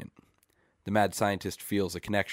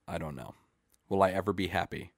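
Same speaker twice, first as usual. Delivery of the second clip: ordinary delivery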